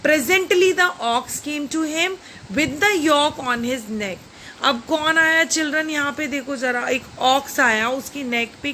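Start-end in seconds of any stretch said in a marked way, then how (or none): 0.1-4.2 put-on slow, measured read-aloud for children; slow story reading. Teacher reading the story slowly to young pupils. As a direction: read each word out slowly to the class.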